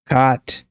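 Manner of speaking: "caught" as "cot", open AH, rising; unrounded AH in "caught"; cot, AH vowel. Shown as a demonstration. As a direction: say "caught" like kaht, tune up. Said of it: "repeating four or five times".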